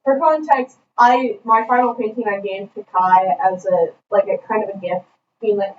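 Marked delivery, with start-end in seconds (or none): none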